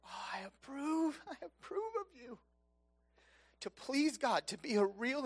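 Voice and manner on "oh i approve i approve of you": high, soft, tearful voice; choked-up approval. Acted. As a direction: let the voice crack as God approves. God approving believer, choked up.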